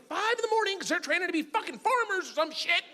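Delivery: high-pitched comic indignation; incredulous outrage. Real, not acted. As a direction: repeat it in high disbelief. Indignant repeat about early wake-up.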